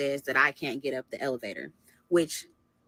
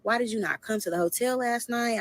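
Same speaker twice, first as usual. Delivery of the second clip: retold yelling; 6 semitones higher than usual. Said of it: "And I start screaming at him".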